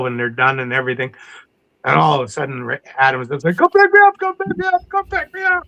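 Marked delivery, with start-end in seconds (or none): 3.6-5.6 frantic, much higher pleading; panicked call for pickup. Retold or imitated. Adam radioing the boat from the dark beach, panicked high plea. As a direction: beg to be picked up fast, voice way up.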